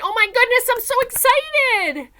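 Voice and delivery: high, loud, excited squeal; hammed-up excited exclamation. Shown as a demonstration. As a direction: burst out with big excitement. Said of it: "Or an exclamation point".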